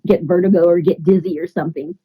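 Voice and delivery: normal talking voice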